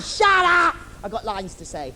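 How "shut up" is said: high, loud shout. Acted. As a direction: bark it at the hecklers. Snaps at heckling audience.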